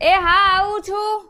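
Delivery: high, drawn-out answering call; calling back. Acted. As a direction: sing out a reply to someone in another room. Answers a call from another room. (લંબાવીને સામી બૂમ પાડવી)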